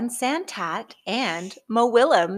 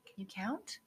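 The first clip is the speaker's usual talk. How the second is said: scared, unvoiced little whisper; 3 semitones lower, 13 dB quieter than usual. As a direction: ask in a frightened whisper. Too afraid to count.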